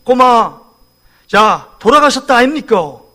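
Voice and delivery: put-on punchy Gyeongsang accent; Gyeongsang dialect. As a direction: imitate a Gyeongsang speaker announcing a death. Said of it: "경상도 사람은 이렇게 얘기해요". (경상도 사투리 흉내)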